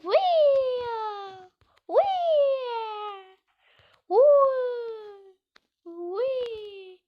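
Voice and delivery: high, loud whooping yell while jumping; gleeful whoop. Real, not acted. Gamer whooping at his big jump in the game, a high gleeful yell. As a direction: let out a wild jump cry. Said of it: "Şöyle zıplama yapalım".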